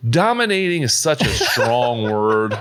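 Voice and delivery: drawn-out words breaking into a laugh. Real, not acted. Golfer coyly playing down his win, laughing. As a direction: stretch it out slowly through laughter.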